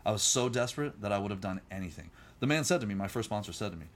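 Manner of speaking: normal talking voice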